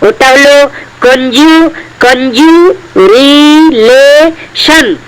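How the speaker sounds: slow sounding out. Acted. Student sounds out a hard word.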